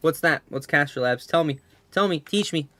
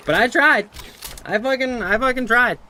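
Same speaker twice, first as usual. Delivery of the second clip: emphatic; 10 semitones higher, 5 dB louder than usual. Real, not acted. Insisting he really tried.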